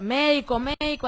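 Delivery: mild mock yell for help; retold shout for a doctor. Prisoner calling for a doctor for a fainting cellmate, urgent high call. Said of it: "yo empecé a gritar".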